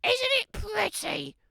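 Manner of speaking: a high, prim granny calling through the door; old granny voice. Acted. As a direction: call out like a frail grandma.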